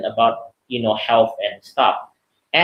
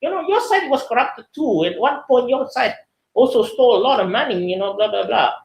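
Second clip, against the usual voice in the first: mocking opponent; 9 semitones higher than usual. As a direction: sneer back like a rival politician. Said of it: "the response from the other side is like".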